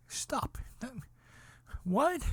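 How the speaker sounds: whispered stop, then a very high what; breathless then a sharp high question; hushed then shrill in shock; stunned disbelief. Retold or imitated. Disbelief at mother's death.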